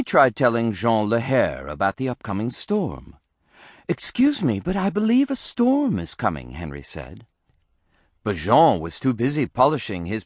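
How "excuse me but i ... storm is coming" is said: high-pitched little bunny voice; polite warning. A narrator's reading. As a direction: politely try to warn, pitched way up. Bunny son politely warns the crew.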